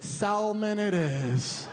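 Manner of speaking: slow, laughing, L in "salmon"; sal-mon with the L, drawn out; the date ordering it, slow, still saying the L. Retold or imitated. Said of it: "the girl I'm with goes".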